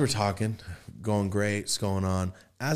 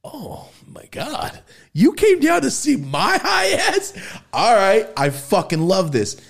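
high, loud, slow thrilled squeal; over-the-top excitement; 14 semitones higher, 7 dB louder, slower than usual. Retold or imitated. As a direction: gush like you can't believe it. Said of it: "But I went".

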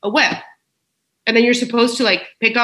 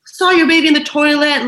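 1 exasperated outrage; 7 semitones higher, 4 dB louder than usual. Retold or imitated. Indignant retold outburst at husband.

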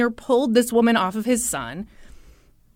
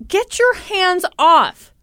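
slow, loud, higher-pitched acted command; angry warning; 7 semitones higher, 5 dB louder, slower than usual. Father in a struggle with the woman, angry. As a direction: imitate a forceful, drawn-out order.

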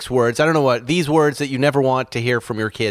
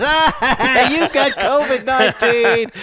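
high, giggling little-brother voice; mocking kid brother cackling the news; laughing kid brother; 11 semitones higher, slower than usual. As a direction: tease it through a laugh like a cheeky kid. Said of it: "his younger brother goes".

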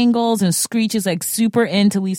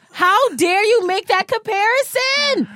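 mock outrage; 11 semitones higher than usual. Mock outrage over comparison.

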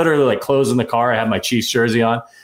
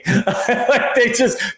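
laughing; 12 semitones higher, much slower than usual. Real, not acted. Laughing at the memory.

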